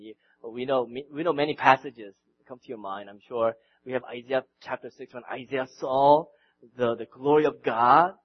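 ordinary delivery

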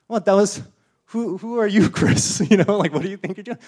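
preacher playing the offended friend, indignant; mock indignation. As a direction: act offended at the nerve of it. Acted indignant quote in example.

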